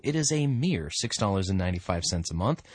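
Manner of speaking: ordinary delivery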